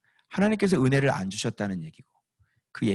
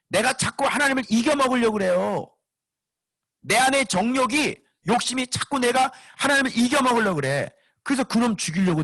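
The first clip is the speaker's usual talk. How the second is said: much higher, pressing preacher's voice; preaching that we must lose to God, much higher; full-force preaching; 13 semitones higher, 4 dB louder than usual. Acted. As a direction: drive the point home from the pulpit.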